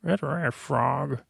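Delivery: soft, slow bedtime take on a puppet pig diva; quiet, stretched-out character voice; Miss Piggy. Retold or imitated. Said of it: "that's my Miss Bedtime Miss Piggy voice".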